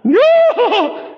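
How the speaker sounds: hammed-up grief of hired mourners; comic shrill lament of old women; fake wailing mourners. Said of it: "three little ladies going".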